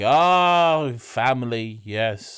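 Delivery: drawn-out, swelling weird "Oh"; lightly acted strange landlord. Landlord hearing of the doctor's granddaughter, a touch weird. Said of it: "he goes real weird for a minute".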